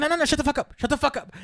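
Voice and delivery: high, urgent cutting-off; frantic hushing. Retold or imitated. Comedian stopping a volunteer mid-sentence, high frantic shushing. Comedian cuts him off in panic.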